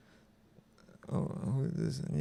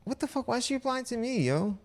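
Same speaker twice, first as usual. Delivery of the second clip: very high-pitched incredulous question; baffled disbelief; 10 semitones higher than usual. Real, not acted. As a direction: squeak it out, indignant and bewildered. Baffled by being replied to.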